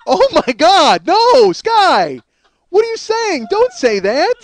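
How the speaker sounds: high, loud, slow horrified cry; loud appalled plea down the phone; horrified shout. Retold or imitated. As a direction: yell it in shocked disbelief. Horrified at what Sky says.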